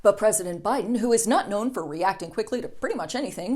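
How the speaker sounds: ordinary talk, nothing marked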